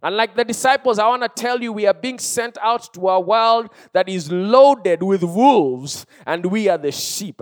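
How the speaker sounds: high, loud, urgent exhortation; forceful pulpit delivery; full-force preaching. Real, not acted. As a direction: drive the warning home to the congregation.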